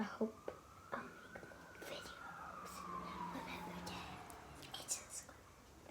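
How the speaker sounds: hushed whisper to viewers; soft breathy whispered outro. Real, not acted. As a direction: whisper it like a secret sign-off.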